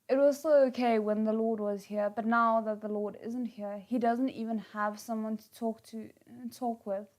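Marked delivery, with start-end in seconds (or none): none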